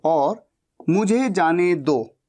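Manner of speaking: usual speaking voice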